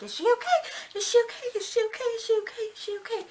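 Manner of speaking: high, panicky repeated question; mock freaking out. Liam in the background asking about her, imitated freak-out. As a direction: imitate someone frantic, very high-pitched throughout. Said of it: "in the background freaking out".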